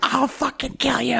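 very high, strained mock rage; hammed-up betrayed fury. Producer turning on the assistant he treated like a son, mock fury. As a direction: wail the threat like a wronged boss, play-acted. Acting Lee's fury at Elliot.

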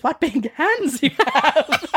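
put-on high, slow storybook girl. Girl noticing grandmother's big hands, high drawn-out wonder. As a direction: draw it out high in mock amazement. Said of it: "And then she says".

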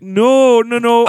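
high, loud Canadian no; Canadian accent. Retold or imitated. She mocks her own slip into a Canadian accent on no. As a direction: stretch the no the Canadian way. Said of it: "that accent's so easy to pick up".

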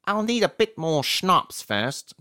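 loud, high, slow character voice; Copley character voice. A narrator's reading. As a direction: draw it out loudly in a high put-on voice. Narrator voices friend Copley.

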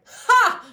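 a sharp mock villain cackle, high and loud; evil cackle. Friend mocks her own texting as an evil cackle. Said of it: "I'd be like".

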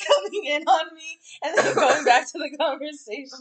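high loud laughter over the words; laughing hard. Real, not acted. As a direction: talk while cracking up.

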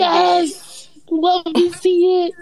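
high, drawn-out cheer; excited cheer. Real, not acted. Fan reacts to hearing the favorite song was played, excited cheer. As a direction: cheer it on, stretching the words.

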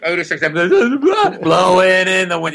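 mock a cop speaking into a walkie-talkie. Cop reporting over walkie-talkie, slow radio squawk. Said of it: "walkie-talkie".

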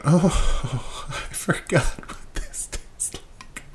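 high, drawn-out retold gasp of delight; mock overcome, voice thinning out high and slow; overcome reaction. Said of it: "I went".

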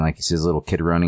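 ordinary delivery

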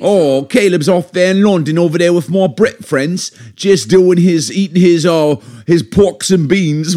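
hammed-up London accent, high and loud. Parent asked to describe son in London, exaggerated London accent. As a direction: put on a comic London accent, pitched well up. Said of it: "Can you do your best London accent?".

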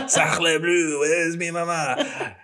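mock French accent, high and slow; hammed-up French chef voice. Joking fake French phrase.

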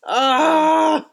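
loud, high drawn-out "ah"; overcome squeal. Real, not acted. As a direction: cry out, overcome with emotion. Overcome at retelling fan's story.

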